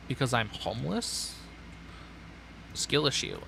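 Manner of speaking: ordinary talk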